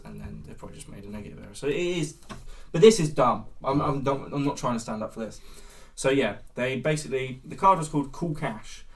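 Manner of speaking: normal talking voice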